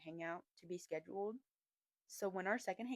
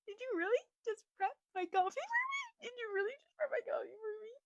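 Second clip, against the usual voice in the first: very high, tiny gushing voice; high-pitched mock-tearful disbelief; overwhelmed squeal; 13 semitones higher than usual. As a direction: squeak it out like you're melting. Repeats it, mock-overwhelmed by sweetness.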